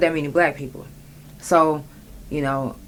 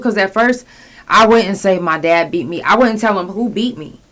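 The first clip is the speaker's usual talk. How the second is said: ordinary talk, nothing marked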